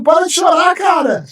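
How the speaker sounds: shouting at himself to stop crying; desperate shout. Retold or imitated. Man alone at home yelling at himself to stop crying, desperate shout. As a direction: yell it out, begging yourself to stop.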